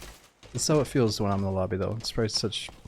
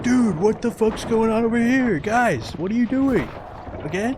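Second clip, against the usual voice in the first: much higher, louder mock outrage, slowed at first; imitating a furious player; 14 semitones higher, 6 dB louder, slower than usual. Streamer imitating the enemy player yelling at teammates, high loud mock outrage. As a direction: squawk like an exasperated teammate. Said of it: "He's like".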